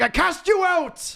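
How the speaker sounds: high, slow, hammed-up decree; theatrical high-pitched condemnation; mock banishment. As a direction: cast them out in an over-the-top drawn-out cry. Continuing the mock banishment speech.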